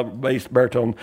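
ordinary talk

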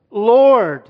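a loud, high, weighty "Lord."; reverent address. Retold or imitated. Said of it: "He just doesn't say in an offhand way".

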